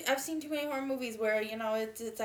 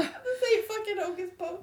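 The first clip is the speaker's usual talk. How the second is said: laughing shout; 6 semitones higher than usual. Retold or imitated. Friend voicing the ghost's retort, laughing shout.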